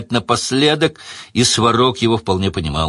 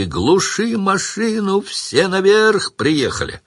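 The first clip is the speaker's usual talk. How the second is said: протяжно и высоко, надрываясь; орать в трубку, напрягая голос; крик в переговорную трубку; 10 semitones higher, slower than usual. A narrator's reading. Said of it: "заорал, надсаживаясь с непривычки". (кричит в переговорную трубку кочегарам, надрываясь)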